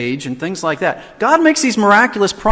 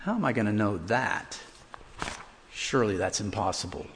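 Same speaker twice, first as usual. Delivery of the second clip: low, soft, scoffing disbelief; sceptical, dismissive murmur; doubtful unbelief; 9 semitones lower, 11 dB quieter than usual. Retold or imitated. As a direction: mutter it like it can't be true. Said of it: "something that indicated unbelief".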